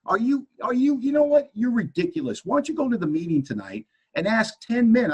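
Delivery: high-pitched exasperated rant at his wife; worked-up quarrel. Retold or imitated. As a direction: sputter into an indignant, high retort.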